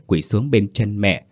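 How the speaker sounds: ordinary delivery, nothing marked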